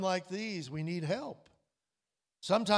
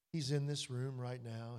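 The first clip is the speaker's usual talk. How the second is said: ordinary delivery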